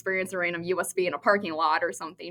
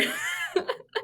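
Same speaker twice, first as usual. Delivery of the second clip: real high laughter trailing off; high squealing laugh; 5 dB quieter than usual. Real, not acted. Guest laughing with the hosts about junk drawers, high squeal.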